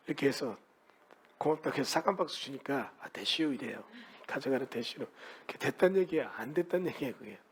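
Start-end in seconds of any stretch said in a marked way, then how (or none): 3.0-3.4 low murmured Chungcheong "아, 됐슈"; a lightly done Chungcheong brush-off, dropped low; Chungcheong dialect. Retold or imitated. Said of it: "충청도 말에 이거 있잖아요". (충청도 사투리로 낮게 툭)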